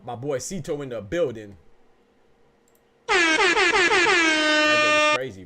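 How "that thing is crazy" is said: very high, loud, drawn-out yell; hyped out. Real, not acted. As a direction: belt it out stretched, hyped. Reacting to a stream alert.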